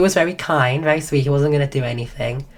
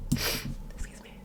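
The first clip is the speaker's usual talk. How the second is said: slow voiceless whisper; a hushed sorry after a cough; whispered apology; 15 dB quieter, slower than usual. Real, not acted. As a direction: whisper it, no voice left.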